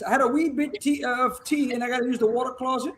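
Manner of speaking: soft, half-hearted Irish lilt; mock Irish, softly spoken; Irish accent. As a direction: put on a little Irish accent, quietly. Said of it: "I'm gonna put a little Irishness".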